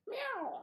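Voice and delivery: high breathy mock cat meow; whispered meow. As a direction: squeak out a little cat meow. Cat noise in the chant.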